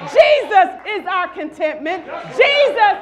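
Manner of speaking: loud, high, driving call to the congregation; full-force preaching. Acted. Pastor rallying the congregation to stay with Jesus, shouting sermon.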